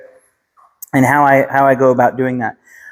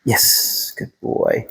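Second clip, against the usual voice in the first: whispered praise; 3 semitones lower, 6 dB quieter, much slower than usual. Real, not acted. Praising dog after command.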